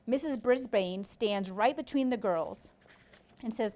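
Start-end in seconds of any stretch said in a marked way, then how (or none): none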